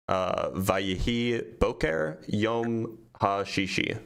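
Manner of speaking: slow, halting Hebrew with stumbles and restarts; rusty Hebrew reading. Real, not acted. Reader struggles through Genesis's last verse, halting rusty Hebrew. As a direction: feel your way word by word through the verse. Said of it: "Sorry, my Hebrew is terrible".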